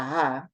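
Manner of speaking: ha with an audible h at the start; rough breathing. Shown as a demonstration. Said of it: "this is clearly a rough breathing".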